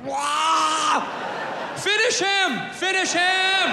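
high shouted Finish him, video game style; fight announcer shout. Retold or imitated. Comic mimicking onlooker cheering a street fight in high shouts. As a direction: yell it like an arcade fight announcer.

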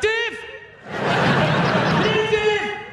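short, high-pitched exclamation, held level; high chirped name. Acted. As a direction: pipe the tiny name out high. Acted punchline answer to pronunciation.

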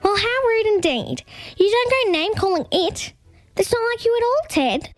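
high, indignant emu scolding; blustering. A narrator's reading. Emu scolds the shopkeeper for name-calling, blustering. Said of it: "blustered Gladys".

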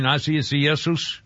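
Asiasus sounded out very slowly, syllable by syllable; Asiasus, dragged out and unsure; struggling with a name. Real, not acted. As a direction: stumble slowly through Asiasus. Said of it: "I can't say it".

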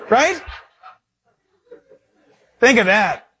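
normal talking voice

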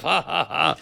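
hollow, spelled-out fake laugh, softly done; forced "ha ha ha"; mock laugh. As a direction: pretend to laugh along, unconvincingly. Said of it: "we can all laugh".

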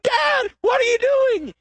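high, slow frightened shout; high panicked childlike cry; panicked child. Acted. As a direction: scream it like a scared little boy. Boy panics again at bee.

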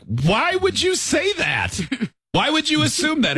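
very high-pitched retold protest; high, hammed-up incredulous questioning; mock indignant. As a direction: squeal it in exaggerated disbelief. Retells indignant reply to kid.

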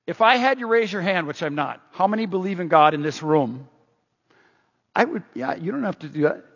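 usual speaking voice

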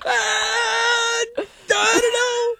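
very high, squealing falsetto parody; hammed-up helpless sportscaster impression; Tony Romo. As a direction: whine it out like a baffled commentator, stretching the last words. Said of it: "just go".

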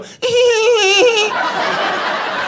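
high squeaky tee-hee giggle; Doughboy giggle. Acted. Comic doing the Doughboy's signature giggle. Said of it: "he'd go".

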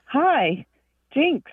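high-pitched mimicked hello in two separate beats; imitated greeting. Librarian mimicking visitors who misread her plate, high imitated greeting. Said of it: "or they'll say, like".

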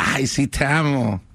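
slow, dismissive "ay, sí, te amo" with a mock laugh; sarcastic reply. Man answering his girlfriend with a sarcastic half-laugh. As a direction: toss it off like you don't mean it. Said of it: "entre risas sarcásticas".

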